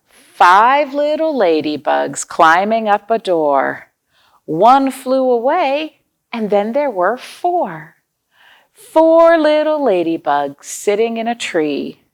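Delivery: slow, sing-song nursery rhyme; counting rhyme. Acted. Teacher recites a counting rhyme to children, slow and sing-song. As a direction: chant it slowly for little ones.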